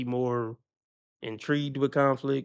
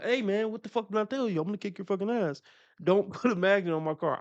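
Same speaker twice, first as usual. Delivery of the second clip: higher-pitched fed-up mock threat; a hammed-up warning, second time round; mock angry threat; 5 semitones higher than usual. Driver warning the magnet guy again, mock angry. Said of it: "I'll give you the second one".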